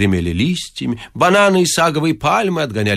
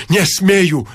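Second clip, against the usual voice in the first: ordinary delivery, nothing marked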